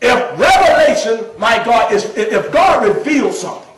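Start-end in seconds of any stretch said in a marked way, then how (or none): none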